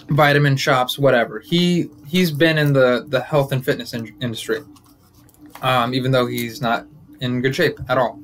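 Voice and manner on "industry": usual speaking voice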